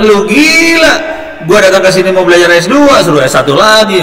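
furious retold yell at the dean; retold angry shout. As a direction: blow up at the dean, shouting in disbelief. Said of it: "Mau berantem lah saya sama dekan, ngotot-ngototan".

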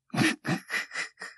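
unvoiced quiet chuckle; a quiet mocking chuckle; soft breathy chuckle. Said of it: "旅僧が笑った".

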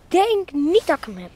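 ordinary talk, nothing marked

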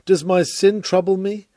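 ordinary talk

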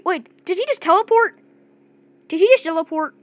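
high, loud stunned gasp of a question; can't believe he vanished; shocked disbelief. Real, not acted. Gamer stunned as the enemy teleports, shocked and loud.

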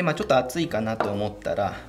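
normal talking voice

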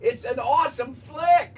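slow, loud, high shout. Real, not acted. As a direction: belt it out slowly. Pushing the movie, shouting.

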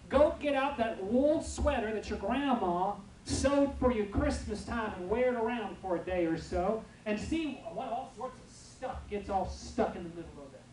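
ordinary talk

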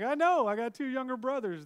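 loud, high-pitched retort; mock exasperated outburst. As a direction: burst out in hammed-up agreement. Acted retort to crying wife.

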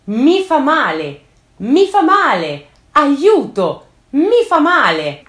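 high, loud, slow cries of pain; pained screaming. Retold or imitated. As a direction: scream it out as if wounded and begging for help. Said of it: "continuando a urlare".

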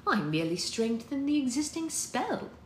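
high-pitched measured reply; quietly defensive. A narrator's reading. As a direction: lift the voice and keep it controlled. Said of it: "Lilith said quietly".

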